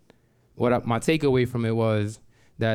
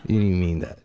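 laughing scoff; 6 semitones lower than usual. Retold or imitated. Scoffing at the boss's promise.

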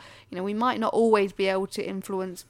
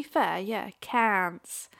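Australian accent; 3 semitones lower, 4 dB quieter, much slower than usual. Shown as a demonstration. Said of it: "very good Australian accent".

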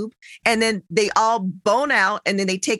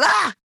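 high, abrupt mock-angry yell; angry nurse's yelp; 9 semitones higher than usual. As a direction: imitate a pissed-off nurse cutting you off. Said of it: "she was pissed that I had asked".

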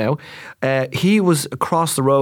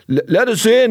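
raised, higher call from outside; muffled call through door; 8 semitones higher, 4 dB louder than usual. Retold or imitated. Firemen at the door calling to be let in, raised voice. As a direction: shout it through the glass door. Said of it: "like this".

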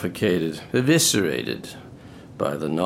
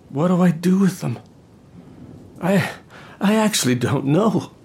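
anguished outburst; 8 semitones higher than usual. Acted.

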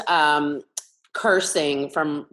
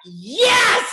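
high, loud triumphant "Yes!"; 4 dB louder than usual. Retold or imitated. Kindergartner delights in the class's shock, shouting a high loud "Yes!". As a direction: cheer like you just won. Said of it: "And I was like".

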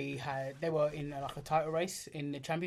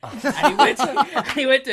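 helpless laughter breaking up the words; high, loud, struggling to talk through real laughter; laughing hard; 8 semitones higher, 12 dB louder than usual. Real, not acted. Telling mates the punchline of the Merson story, laughing hard.

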